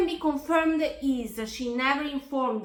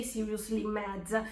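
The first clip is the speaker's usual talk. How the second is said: seething mutter; 3 semitones lower, 6 dB quieter than usual. Real, not acted. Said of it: "just reading these messages made me mad".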